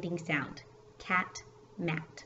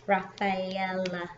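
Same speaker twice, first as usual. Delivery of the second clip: RAFAELA spaced out slowly beat by beat; slow syllable-counting "Rafaela"; four syllables; much slower than usual. Shown as a demonstration. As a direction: break "Rafaela" into four slow, even beats. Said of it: "four syllables".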